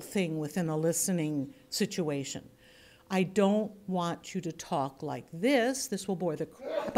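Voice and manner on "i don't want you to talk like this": slow, dragged-out demonstration; too slow. Shown as a demonstration. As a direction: draw out each word tediously. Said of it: "I don't need you to be slow".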